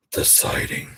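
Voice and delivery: eerie whispered echo; whispering. Acted. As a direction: breathe it out like a ghost story. Spooky echo of own words.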